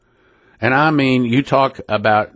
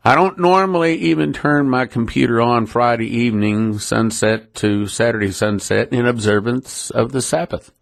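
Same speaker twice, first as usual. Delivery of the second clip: ordinary talk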